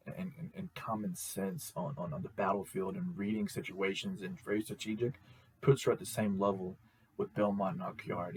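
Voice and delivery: ordinary talk, nothing marked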